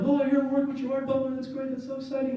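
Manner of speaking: hammed-up thrilled crew; mock excitement. Film crew gushing about the star, mock excitement. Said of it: "They're like".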